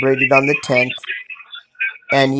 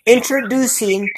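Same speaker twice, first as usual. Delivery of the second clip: show announcer; 9 semitones higher than usual. Real, not acted. Host bringing a young guest onto the show, announcing.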